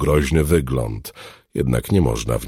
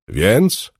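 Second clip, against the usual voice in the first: raised-pitch impatient prod; urging; 7 semitones higher, 3 dB louder than usual. A narrator's reading. Smuggler hurries the alchemist to answer, urging. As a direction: press him for an answer, pitch jumping up. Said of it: "ponaglił dżuga".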